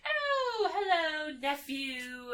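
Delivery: high singsong greeting, sliding down; mock-gleeful villainess, drawn out from a high squeal; crazy Bellatrix. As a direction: greet them in a high, unhinged coo. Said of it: "being crazy pants".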